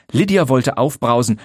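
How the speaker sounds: ordinary delivery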